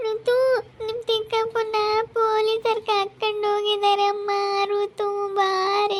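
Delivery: put-on high, crying plea to the child; tearful. Mother crying to her daughter that police took her uncle. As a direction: weep as you tell her, slowly.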